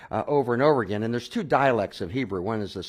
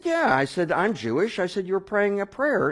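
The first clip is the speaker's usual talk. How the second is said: much higher and louder, eager; excited insistence; 10 semitones higher, 4 dB louder than usual. Retold or imitated. Telling the stranger he prayed in Hebrew, high and excited. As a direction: hammer home the discovery.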